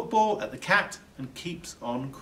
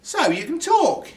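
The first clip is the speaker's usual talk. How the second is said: much higher, louder surprise; surprised; 9 dB louder than usual. A narrator's reading. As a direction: react amazed the baby speaks. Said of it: "he says".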